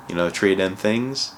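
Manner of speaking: ordinary delivery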